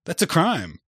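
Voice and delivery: mock-official scolding. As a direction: exclaim it as a scandalized government official. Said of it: "Yeah, he's like".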